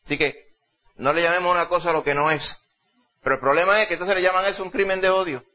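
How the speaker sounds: ordinary talk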